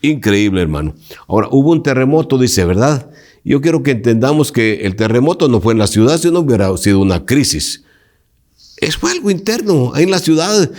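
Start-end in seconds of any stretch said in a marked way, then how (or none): none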